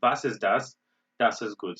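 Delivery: Was ist das and Das ist gut said in German; German phrases. Shown as a demonstration. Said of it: "they would say".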